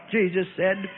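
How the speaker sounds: ordinary delivery, nothing marked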